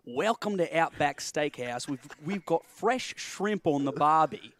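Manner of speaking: hammed-up Australian commercial announcer; Australian steakhouse commercial. Shown as a demonstration. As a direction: do a hearty Aussie restaurant ad voice. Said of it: "this is my impersonation of an Outback Steakhouse commercial".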